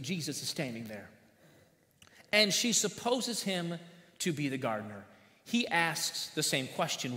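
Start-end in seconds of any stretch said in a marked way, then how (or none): none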